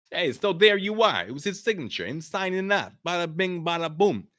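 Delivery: high, brash Brooklyn wiseguy; a Brooklyn reporter wrapping it up; Brooklyn accent. Acted. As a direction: bada bing, bada boom, full New York.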